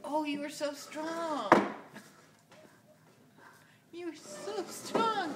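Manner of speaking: excited praise. Real, not acted. Praising toddler.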